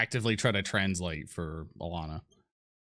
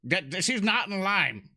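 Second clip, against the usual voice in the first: high, stammering objection; exasperated protest; 10 semitones higher than usual. Acted. Arguing that the ogre is out of line, exasperated protest. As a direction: protest in a high voice, stretching the key words. In-character argument over the ogre.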